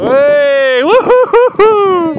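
loud, high whooping cheer; joyful cheer. Real, not acted. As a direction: whoop with delight. Dog rescued, cheering.

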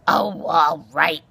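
slow, loud grudging growl. A narrator's reading. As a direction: give in with a grumpy growl. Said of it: "he growled".

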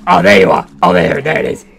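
normal talking voice

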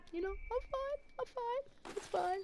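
very high, thin-pitched "I'm fine"; feigned okayness. Pretend. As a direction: insist you're fine, far too high. Feigns being fine about lost kill.

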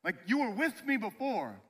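high, loud, insistent plea; pleading. Acted. Elijah telling God he can't do it alone, pleading.

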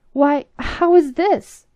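the captain bursts out, shocked; crying out. Acted. Captain objects to the princess's wedding, loud shocked cry. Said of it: "cried the young captain".

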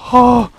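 high, loud startled "oh"; startled cry. Real, not acted. Gamer caught off guard, startled yelp. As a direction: yelp in sudden fright. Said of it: "that scared me".

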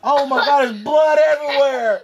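hammed-up shock; mock alarm. Grandpa fakes alarm over head bump.

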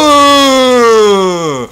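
long, loud falling "ooh"; drawn-out whoop. Real, not acted. Player hypes his own play, drawn-out whoop. Cry after a play in game.

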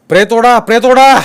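high, loud repeated command; urgent shout. A narrator's reading. Officer urging men to pull the body, shouting. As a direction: bark the order to keep pulling. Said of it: "फौजदार ओरडला".

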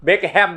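Beckham read as written, BECK-HAM; wrong spelling pronunciation. Shown as a demonstration. Teacher showing a name nobody uses, Beckham with full HAM. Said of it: "ไม่มีนะ ชื่อ Beckham".

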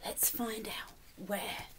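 slow, soft hush of a secret; whisper. Real, not acted. Reader whispering to the child, slow and hushed. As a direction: lean in and breathe it.

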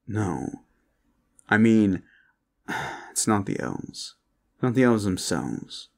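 ordinary delivery

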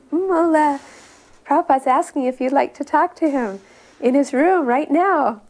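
high, loud excited calling out; a friend rushing down the stairs, calling her name up high. Retold or imitated. Friend bursting in to tell her the news, calling her excitedly.

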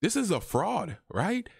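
high, knowing aside; lightly voiced inner doubt; inner skeptic. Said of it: "I'm just like".

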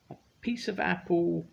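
high, slow little bird voice; slow, sweet bird request; high robin voice. Acted. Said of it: "said the robin".

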